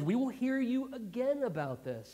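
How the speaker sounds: acted open-but-unsure listener; pondering reply. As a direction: stretch it out as if weighing it up. Acts out the undecided hearers.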